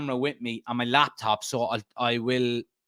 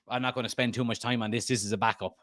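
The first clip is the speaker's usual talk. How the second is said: normal talking voice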